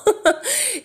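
high, breathy laugh on the edge of tears; laughing at herself after the realization, a high teary laugh. Real, not acted. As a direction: let a high laugh break out.

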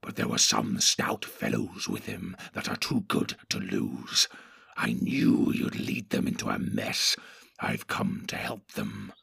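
a low menacing creature, scheming; Grishnak the sly orc. Acted. Grishnak retorts.

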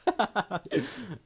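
high burst of giggles; real laugh. Real, not acted. Laughs at own story.

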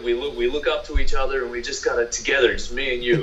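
mock American pep talk to partner; American accent. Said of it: "just do whatever you want in an American accent".

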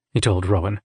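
usual speaking voice

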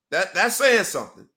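ordinary talk

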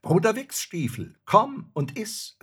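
high, loud hail to a comrade; cheery soldier calling his pal over. A narrator's reading. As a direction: sing out the invitation loudly. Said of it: "rief er dem Jäger zu".

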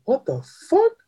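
high, incredulous mock reaction; shocked disbelief. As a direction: squeak it out in retold shock. Retelling his shocked reaction.

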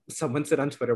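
ordinary talk, nothing marked

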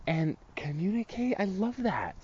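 high, soft and eager; chipper delight. Retold or imitated. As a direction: gush like it's the best idea ever. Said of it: "here's what I said".

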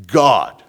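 God stamped loud; emphatic God. Shown as a demonstration. Preacher echoing Genesis with a loud emphatic God. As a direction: state God as settled fact. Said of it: "He says it emphatic".

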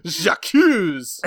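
high, loud, theatrical cry; hammed-up j'accuse; melodramatic accuser. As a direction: point the finger grandly. Mock dramatic accusation.

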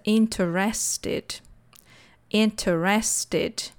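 "interested" slowly as in-ter-RES-ted; four syllables in "interested", weight on RES; alternative pronunciation. Shown as a demonstration. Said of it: "já ouvi essa pronúncia".

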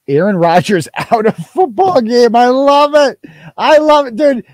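gleeful laugh. Real, not acted. Gleeful reaction to Rodgers injury.

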